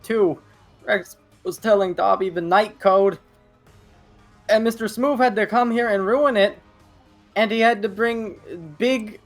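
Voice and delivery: high, loud, breathless dismay; shocked anime girl. Acted. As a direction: wail it like a panicked anime heroine. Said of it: "And it's like".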